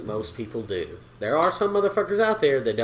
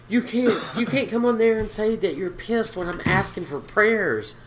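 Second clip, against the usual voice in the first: mom scolding; 7 semitones higher than usual. Retold or imitated. Mom's scolding text, retold.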